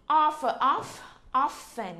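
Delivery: "off, off, often" building the word by parts; syllable drill. Shown as a demonstration. Teacher builds up "often" syllable by syllable for students. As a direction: break "often" into OFF then whole. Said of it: "chúng ta có từ often".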